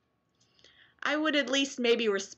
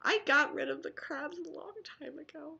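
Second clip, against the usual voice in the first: secretive whispered aside; 7 semitones higher, 8 dB quieter than usual. Acted. Joking confession to viewers, whispered. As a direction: confide it in a hushed whisper.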